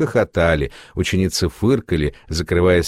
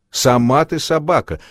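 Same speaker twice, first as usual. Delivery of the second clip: raised pitch, a touch of a cry; mild shout; 6 semitones higher than usual. A narrator's reading. Seamstress retorts to insolent young Tanechka, mild shout. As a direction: snap back in an understated narrated shout. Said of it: "крикнула она Танечке".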